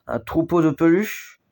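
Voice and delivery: plain reading voice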